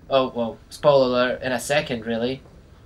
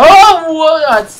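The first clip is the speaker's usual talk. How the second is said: loud startled yelp; 13 semitones higher, 15 dB louder, much slower than usual. Real, not acted. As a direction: jump at the sudden snake. Startled by a snake.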